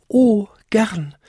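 high tinkling little bell; Vinzi. A narrator's reading. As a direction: chime it eagerly and high. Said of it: "bimmelte Vinzi". (hoch bimmelndes Glöckchen)

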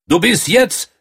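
loud, high royal roar; thundering. A narrator's reading. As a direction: boom it out at the prince. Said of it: "donnerte er".

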